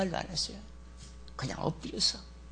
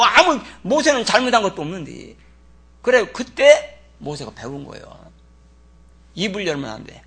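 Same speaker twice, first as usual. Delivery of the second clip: ordinary delivery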